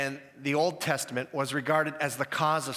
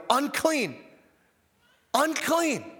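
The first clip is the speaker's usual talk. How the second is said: calls of "Unclean!", much higher and louder; yelling unclean; 9 semitones higher, 4 dB louder, slower than usual. Retold or imitated. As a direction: warn everyone off with the cry "Unclean!". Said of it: "he has to yell".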